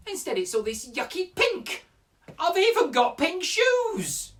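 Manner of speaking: put-on girlish voice, high and petulant; eight-year-old girl. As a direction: complain like a small child. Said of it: "You have to imagine I'm an eight-year-old girl".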